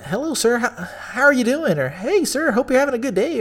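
high, sugary polite greeting; customer-service voice. Retold or imitated. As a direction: sell it like a chirpy bank teller. Retold polite bank greeting.

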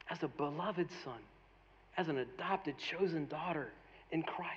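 soft, hushed preaching; gentle, quiet words of reassurance; tender appeal. Real, not acted. Tender appeal about being God's child.

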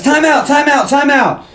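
high, repeated call to halt; mock urgent interjection. Dad halting the backyard chaos, mock urgent. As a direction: imitate someone frantically stopping a fight. Voicing Cal halting the brawl.

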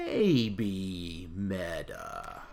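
slow drawl. Acted. Catchphrase drawn out.

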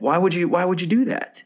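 high exasperated question. Retold or imitated.

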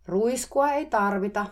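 slow, high, loud call across the yard; shouting back. Retold or imitated. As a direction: yell it back slowly. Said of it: "mä huusin takaisin". (hidas kova huuto takaisin)

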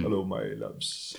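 low, slow, soft Welsh thespian; Richard Burton. Acted. Co-host mimicking a famous Welsh actor, deep slow impression. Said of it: "His best impression of Richard Burton".

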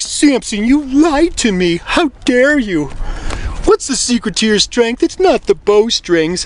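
high, drawn-out mock-offended woman; indignant Delilah. Delilah scolds Samson, acted.

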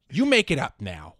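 loud, cocky retort; mock defiance. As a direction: talk back boldly. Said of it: "He should have just been like".

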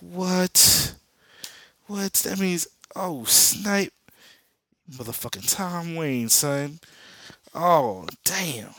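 high, loud hammed-up astonishment; stunned shock. Comic fan acting out Barry learning of Tom Wayne, stunned. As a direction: play up a jaw-dropping reveal at high pitch.

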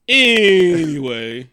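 loud, high, long-drawn ANYWAY; exaggerated segue. Host changing subject after oversharing, exaggerated segue. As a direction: stretch it out to cut off your own rant. Breaks off a confessional rant.

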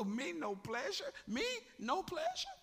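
high, giggling disbelief; laughing Sarah. Acted.

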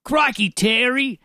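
broad joke Australian accent; put-on Australian accent. Shown as a demonstration. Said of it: "you got an accent for us".

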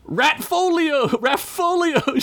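high, drawn-out incantation cry; theatrical flourish. A narrator's reading. Narrator voices Ugla calling up a magical raft, with a flourish. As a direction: cast it like a showy spell, voice lifted high. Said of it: "she said with a flourish".

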